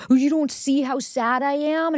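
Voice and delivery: high, slow, whiny mock-sad voice; mock self-pity. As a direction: ham up the wounded drama. Mocking dramatic bids for attention.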